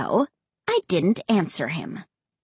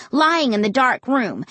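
plain reading voice

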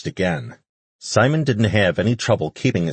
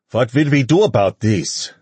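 put-on heavy Russian accent, raised and loud; 5 semitones higher, 6 dB louder than usual. A narrator's reading. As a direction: ask it in a thick Russian accent. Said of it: "one man asked with a heavy Russian accent".

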